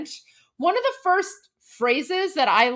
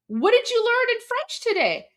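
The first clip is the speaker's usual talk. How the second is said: high, bright parent question; lightly voiced parent asking their child; curious parents; 10 semitones higher than usual. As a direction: ask like an eager parent. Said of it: "My parents are like".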